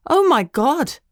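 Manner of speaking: gushing. A narrator's reading. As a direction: squeal it out over the puppy. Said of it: "she gushed".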